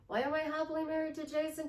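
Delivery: high, quick, sustained-pitch recitation; playful self-questioning. Acted. Happy wife affirming her marriage, playful high singsong. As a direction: rattle it off high and giddy.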